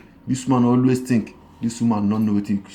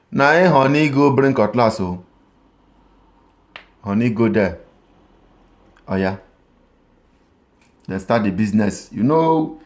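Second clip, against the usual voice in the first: ordinary delivery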